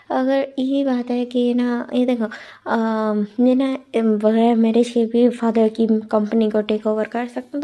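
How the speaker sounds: plain reading voice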